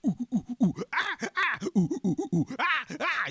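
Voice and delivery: high, loud monkey hoots and shrieks; monkey imitation. Man in a monkey skin performing at the zoo, monkey calls. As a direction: whoop like a monkey jumping round a cage. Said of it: "he's doing the whole monkey thing".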